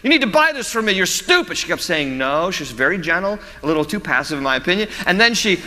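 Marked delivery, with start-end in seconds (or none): 0.0-1.6 put-on high whiny child yelling at mom; mock bratty kid yell. Preacher imitating a bratty child yelling at his mom, high and whiny. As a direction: imitate a brat insulting his mother, pitched up high. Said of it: "he just kept saying it".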